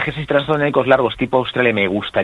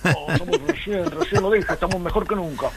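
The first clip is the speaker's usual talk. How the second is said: hammed-up Argentine politician, pitched way up; Milei; 10 semitones higher than usual. Shown as a demonstration. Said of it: "Milei, por ejemplo".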